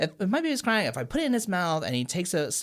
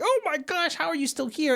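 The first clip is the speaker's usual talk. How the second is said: much higher panicked cartoon babysitter; panicked Lipschitz. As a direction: squeak it out in alarm. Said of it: "immediately he's like".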